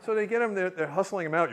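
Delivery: normal talking voice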